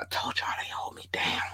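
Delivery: whispered in-joke to listeners; whispered aside. Real, not acted. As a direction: confide it in a hush. Whispered aside about Nevada.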